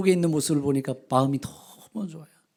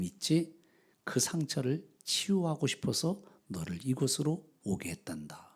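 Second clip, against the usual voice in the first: lower, softer tender reassurance; gentle explanation; 5 semitones lower, 4 dB quieter than usual. A narrator's reading. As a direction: soothe a wounded son quietly. God gently explains to the father.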